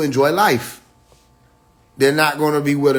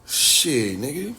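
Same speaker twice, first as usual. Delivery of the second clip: ordinary delivery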